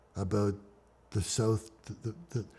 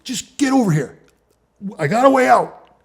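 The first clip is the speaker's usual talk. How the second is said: insistent shout; 13 semitones higher, 8 dB louder than usual. Retold or imitated. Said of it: "I'm like".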